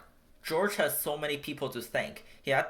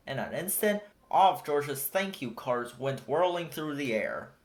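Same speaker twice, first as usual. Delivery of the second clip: ordinary delivery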